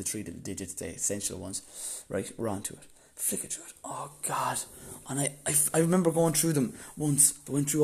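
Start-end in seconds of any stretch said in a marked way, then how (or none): 3.2-4.6 whispered under the breath; whispered dread. Retold or imitated. Searching the phone for the card digits, whispered despair. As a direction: hiss it out in dismay. Anxious search through photos.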